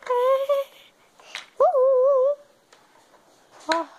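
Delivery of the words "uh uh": squeaky, loud uh uh laughs; high loud laughing grunts. Acted. Laughing at a fall. (risadinhas agudas e fortes)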